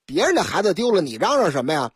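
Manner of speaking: ordinary talk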